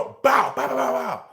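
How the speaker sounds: imitated gunshots, mouth pops; gunshot noises. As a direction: spit out mock gunfire. Imitating gunshots after the plea.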